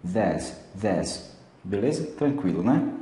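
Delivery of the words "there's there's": "there's" short, low, without stress; unstressed "there's". Shown as a demonstration. Teacher repeating "there's" for learners, unstressed and low. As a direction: say "there's" lightly, letting it drop. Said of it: "o there's não tem estresse".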